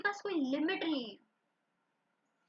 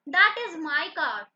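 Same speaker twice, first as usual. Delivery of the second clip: "That" hit hard, louder and slow; stress on That; 3 semitones higher, 12 dB louder, much slower than usual. Shown as a demonstration. Teacher to class, stressing "That" loudly. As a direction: lean on "That" to point at the pronoun. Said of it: "क्या that के बाद यहाँ पे कोई noun है?".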